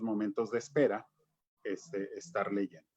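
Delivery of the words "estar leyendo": ordinary delivery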